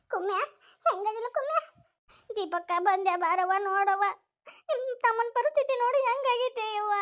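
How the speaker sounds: high-pitched wailing distress; pleading, high and tearful; distraught lament. Acted. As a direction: lament over an injured loved one. Woman laments injured brother's condition.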